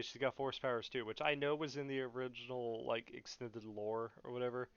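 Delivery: normal talking voice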